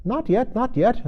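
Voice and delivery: hasty interruption. A narrator's reading. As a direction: jump in before the king finishes. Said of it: "the rabbit hastily interrupted".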